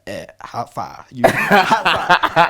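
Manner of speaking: imitating the housemaster's Nigerian accent, the second "how far" slow and loud; a teasing Nigerian greeting, stretched out; mock Nigerian accent. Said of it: "he'll come to me and be like".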